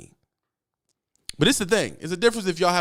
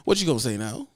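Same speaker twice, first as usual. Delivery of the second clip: usual speaking voice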